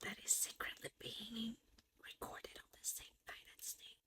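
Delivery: hushed secret whisper. Real, not acted. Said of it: "secretly whispered aside".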